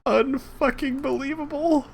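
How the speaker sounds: high, strained disbelief; fed up with the game; exasperated. Real, not acted. Frustrated at crashing game.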